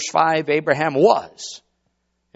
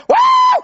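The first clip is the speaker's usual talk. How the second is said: very high, loud whoop; excited whooping wow; 9 dB louder than usual. Acted. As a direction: let out an amazed shout. Acted excitement at breaking news.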